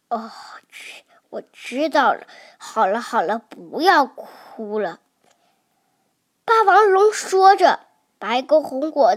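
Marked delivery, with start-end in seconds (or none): 0.1-5.0 soothing dad. Acted. Dad comforts crying baby.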